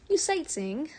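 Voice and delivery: high, loud, cheery guard voice; a friendly gate guard teasing a visitor; cheerful guard. Acted. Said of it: "at the man's cheerful tone".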